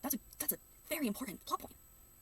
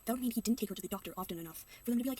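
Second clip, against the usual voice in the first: ordinary talk, nothing marked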